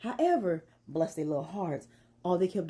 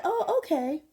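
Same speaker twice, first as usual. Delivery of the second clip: mock surprise; 11 semitones higher than usual. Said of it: "I said".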